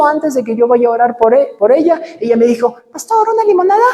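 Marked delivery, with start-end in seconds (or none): 2.9-3.8 put-on much higher, light girlish voice; girl offering lemonade. The young woman offers the pastor lemonade, high and girlish. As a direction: play the young woman sweetly offering a drink.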